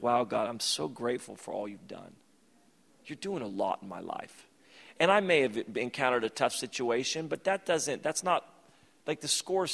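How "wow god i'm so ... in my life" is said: soft, quiet thankful prayer; grateful prayer. Retold or imitated. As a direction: murmur gratitude to God. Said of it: "you begin to understand, like".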